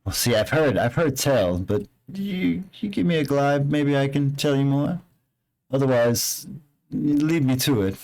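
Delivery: hint at dark secrets, fishing for payment; ominous local NPC. Acted. NPC lore-telling ominously.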